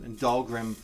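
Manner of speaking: ordinary delivery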